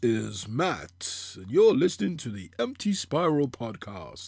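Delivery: slow, put-on announcer intro; hammed-up radio host opening, drawn out; podcast voice. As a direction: play the podcast host, slowly announcing the show. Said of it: "I've been working on my podcast voice".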